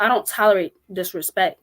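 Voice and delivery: ordinary talk, nothing marked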